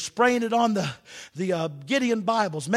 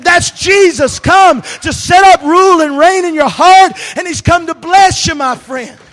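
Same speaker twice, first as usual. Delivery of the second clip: much louder, higher rejoicing shout; jubilant shout; 7 semitones higher, 17 dB louder than usual. Retold or imitated. Preacher celebrating a new convert, shouting for joy. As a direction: shout the good news to the heavens.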